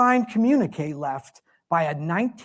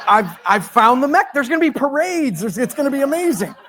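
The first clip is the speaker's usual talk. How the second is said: giddy laughter, higher and louder; laughing excitedly; 6 semitones higher, 5 dB louder than usual. Retold or imitated. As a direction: laugh through the fantasy of glory. Retelling his imagined triumph, laughing.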